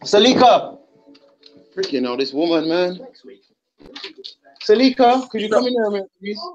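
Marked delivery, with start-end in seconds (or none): 0.0-0.8 higher-pitched summoning call. Real, not acted. Man summoning his fiancee from the kitchen, calling out. 4.6-6.4 high-pitched request called through the house; calling out. Real, not acted. Man asking his fiancee to come in, calling out.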